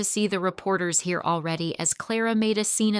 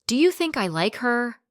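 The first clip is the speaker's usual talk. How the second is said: yelled back; 5 semitones higher, 4 dB louder than usual. Acted. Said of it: "he yelled back strenuously".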